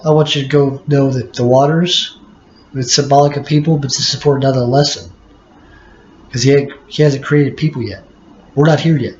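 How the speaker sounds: ordinary talk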